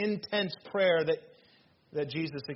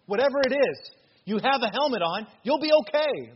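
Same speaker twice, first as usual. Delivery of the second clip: higher and louder, building to a cry; rousing preaching; 7 semitones higher, 6 dB louder than usual. Real, not acted. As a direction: fire them up. Preacher rallies congregation to fight.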